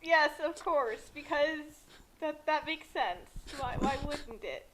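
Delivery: usual speaking voice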